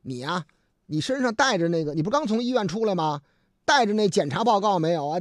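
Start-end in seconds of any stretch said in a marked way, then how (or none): none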